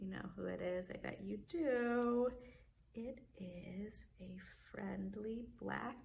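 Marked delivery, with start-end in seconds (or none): 2.9-6.0 soft, slow unvoiced whisper. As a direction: whisper the clue slowly. Suspense before the reveal.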